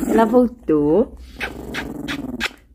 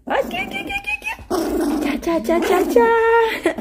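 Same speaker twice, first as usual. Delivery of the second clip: very high coaxing call; 10 semitones higher than usual. Real, not acted. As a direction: coax the dog over, high. Calling dog in high voice.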